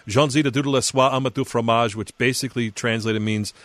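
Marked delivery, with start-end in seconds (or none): 0.1-2.0 mock French gibberish, lightly done; fake French. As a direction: pretend to speak French. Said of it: "he would pretend to speak French".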